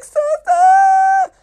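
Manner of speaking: imitated high, loud squeal; shrill laughing shriek. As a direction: shriek it through mock helpless laughter. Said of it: "it turns into a shrill".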